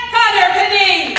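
big, loud stage announcement of the name; announcer call-out. Acted. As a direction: boom the name out like a ring announcer. Announcer calls him onto stage.

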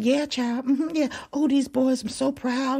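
high, sweet bragging mother voice; high mock society mom chat; proud mom. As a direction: chirp like a proud mom at the banquet. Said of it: "And she was like".

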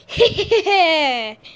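loud gleeful villain cackle; evil laugh. Pretend. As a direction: cackle like a cartoon baddie.

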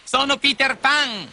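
put-on high, slow, proud boy; Peter's own voice. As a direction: declare yourself boldly at a high pitch. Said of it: "rispose Peter con la sua voce".

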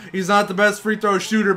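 ordinary talk

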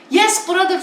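mock churchgoer. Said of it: "falan yapıyor böyle".

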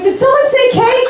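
cake said in a high, eager squeal; mock excited. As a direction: perk up like a hungry kid at the word cake. Eager joke reply, very high.

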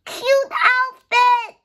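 high, slow, gushing delight; swooning over a tiny doll outfit. Real, not acted. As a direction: draw it out like you adore it.

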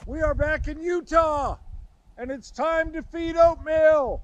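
cheery, drawn-out announcement; excited call. Real, not acted. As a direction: sing it out across the campsite. Calling out on arrival.

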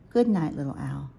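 ordinary delivery

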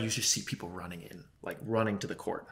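ordinary delivery

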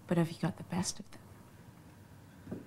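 soft breathy whisper; insinuating whisper to Dave. Acted. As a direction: lean in and whisper it. Flirty insinuating question.

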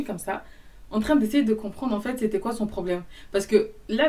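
ordinary talk, nothing marked

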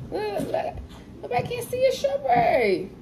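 very high cooing voice. Real, not acted. Mom talking to her baby.

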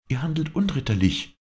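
the lady calls out in protest; cried out. A narrator's reading. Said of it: "rief ihm die Dame zu".

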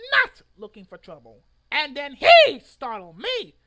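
loud rant blaming the assistant manager; indignant rant. Real, not acted. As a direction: shout your innocence. Ranting defense of own actions.

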